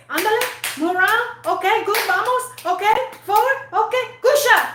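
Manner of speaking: put-on high, sing-song Spanish-accented coach cheer; hammed-up Spanish coach calling encouragement; mock Spanish coach accent. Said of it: "He said in different ways".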